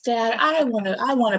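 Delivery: put-on high, eager girl voice; daughter voice. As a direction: play the excited little girl. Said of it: "daughter voiced in story".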